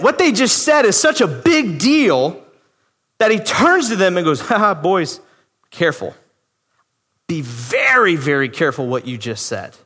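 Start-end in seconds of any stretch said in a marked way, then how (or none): 4.4-9.7 low, measured word of caution; cautioning warning. Acted. Preacher as Jesus turns to the Pharisees, cautioning.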